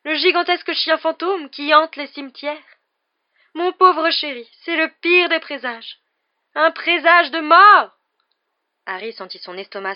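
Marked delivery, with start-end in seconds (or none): 0.0-8.0 high, loud, slow proclamation of doom; melodramatic doom-laden seer. Acted. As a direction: stretch it out, building to a loud cry.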